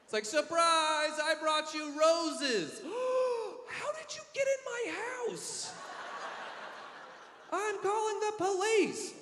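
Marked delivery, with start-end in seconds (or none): 0.2-2.8 creepy surprise. Pretend. 2.8-9.1 terrified woman. Pretend.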